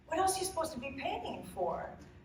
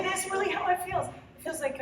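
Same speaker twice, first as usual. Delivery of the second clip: ordinary talk, nothing marked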